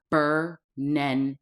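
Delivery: Bernenda spelled out slowly, every syllable clear; slow, deliberate Bernenda; name said carefully. Shown as a demonstration. Introducing herself to viewers, slowly spelling out her name. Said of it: "Not Brenda".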